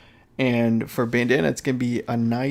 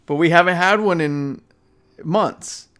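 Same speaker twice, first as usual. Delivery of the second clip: exasperated; 6 semitones higher, 7 dB louder than usual. Real, not acted. Complaining about no directs.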